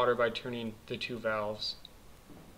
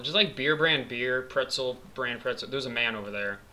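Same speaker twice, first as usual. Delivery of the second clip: normal talking voice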